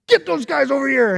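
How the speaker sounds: high, loud angry order; mad king. Acted. Angry king calling guards, loud order. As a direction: bark for them to be fetched. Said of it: "the king was really mad".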